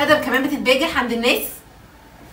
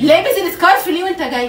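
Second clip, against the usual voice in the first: louder, angry repeat of the question; angry demand; 5 semitones higher, 6 dB louder than usual. Real, not acted. Wife demanding why he wore the scarf, angry and loud. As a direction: press him hard for an answer.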